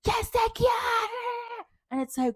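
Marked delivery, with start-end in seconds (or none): 0.1-1.1 very high, drawn-out mock shout; imitated yelling teacher. Mimicking the teacher shouting at his students, mock shout. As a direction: belt it out like the screaming teacher. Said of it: "how loud you would just hear".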